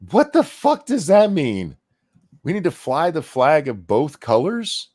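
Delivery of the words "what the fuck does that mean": slow, loud, incredulous what the fuck; drawn-out disbelieving outburst; exasperated outburst. Real, not acted. Mocking debate line.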